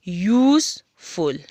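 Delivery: "यूज़फुल" slowly in two beats, a gap between यूज़ and फुल; syllable by syllable. Shown as a demonstration. Teacher showing two syllables to pupils, "यूज़फुल" slowly split. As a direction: stretch "यूज़", pause, then "फुल". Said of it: "यानी कि दो syllable".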